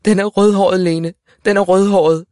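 loud, high, emotional announcement; tearful shout. A narrator's reading. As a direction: call it out, overcome with tears. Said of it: "råbte med grådkvalt stemme".

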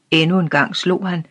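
usual speaking voice

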